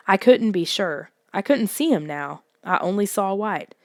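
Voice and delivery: usual speaking voice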